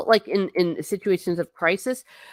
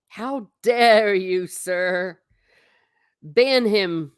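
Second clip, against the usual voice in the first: mock outrage; 3 semitones higher, 4 dB louder, much slower than usual. Mock outrage at viewer's chant.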